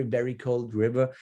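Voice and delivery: ordinary delivery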